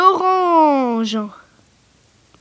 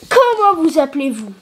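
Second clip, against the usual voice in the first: usual speaking voice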